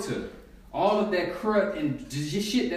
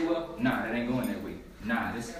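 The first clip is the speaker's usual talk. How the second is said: lowered voice, explaining calmly; lower voice quoting; 11 semitones lower, 7 dB quieter than usual. Pretend. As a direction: drop low and set them straight. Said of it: "and say".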